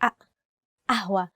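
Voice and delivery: قهوة with a glottal stop, ahwa; glottal stop pronunciation. Shown as a demonstration. Teacher showing the Egyptian glottal stop. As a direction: say قهوة opening on a catch in the throat. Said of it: "we use the letter أ".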